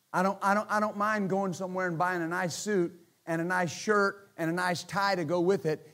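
usual speaking voice

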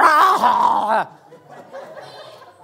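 loud burst of laughter; laughing at himself. Real, not acted. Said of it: "That is not joy and peace".

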